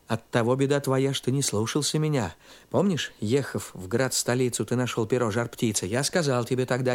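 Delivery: low little horse voice. A narrator's reading. The little horse gently scolds Ivan, low and quick. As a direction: speak low and fast as a wise little horse.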